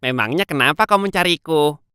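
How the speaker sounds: mildly curious question; a touch of puzzled interest; curious hare. Acted. Hare asks the tortoise why he was looking, mildly curious. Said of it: "Kelinci bertanya dengan penasaran".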